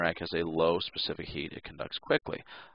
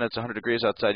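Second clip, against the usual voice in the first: normal talking voice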